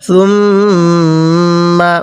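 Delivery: সুম্মা with the doubled mim held long through the nose; nasal ghunna. Shown as a demonstration. Teacher shows the students সুম্মা with a long nasal ghunna. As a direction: hum the mim in সুম্মা, very slow and drawn out. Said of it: "এই মিমটাকে গুণনা করব".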